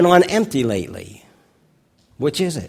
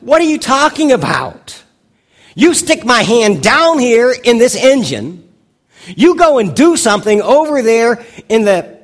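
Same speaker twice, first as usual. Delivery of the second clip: higher, louder incredulous protest; exasperated outburst; 9 semitones higher, 8 dB louder than usual. Retold or imitated. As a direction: let the frustration boil over in disbelief. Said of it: "And Briscoe says".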